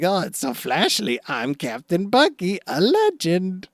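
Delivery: high, drawn-out preening showman; Buggy. Retold or imitated. Buggy shows off his flashy filter on air, hammed-up boasting. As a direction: show off grandly in a put-on voice.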